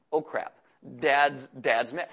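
low, slow "uh-oh" dread; halting, sinking voice of mild mock alarm. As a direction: play a kid realizing trouble is coming. Kids dreading angry dad's arrival.

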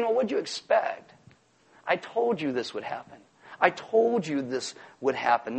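soft, low, unhurried reminder; calm reassurance. Acted. Jesus reminding followers he foretold it, quiet and matter-of-fact. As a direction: say it gently, as no surprise.

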